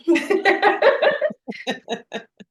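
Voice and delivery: high, loud burst of laughter; real laugh. Real, not acted. Guest asked what her name means, high real laughter.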